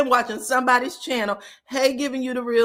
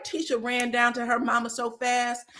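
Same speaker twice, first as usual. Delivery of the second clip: ordinary talk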